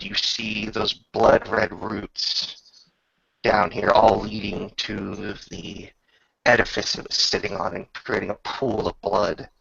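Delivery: ordinary talk, nothing marked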